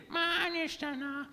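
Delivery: very high, soft parrot squeak; squeaky parrot. A narrator's reading. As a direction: chirp it like a parrot. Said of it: "He opened his beak and repeated".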